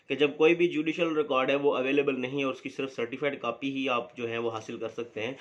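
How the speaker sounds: normal talking voice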